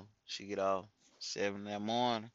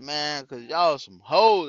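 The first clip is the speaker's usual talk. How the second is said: louder and slow, teasing comeback; loud slow retort; 5 semitones higher, 13 dB louder, slower than usual. Retold or imitated. KT answers the complaining friends, loud slow taunt. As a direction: drop the insult loud and slow. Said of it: "I be like".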